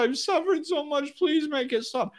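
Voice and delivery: high hammed-up tearful whine; mock crying. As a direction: imitate someone begging through tears. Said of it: "they're crying and they're all emotional".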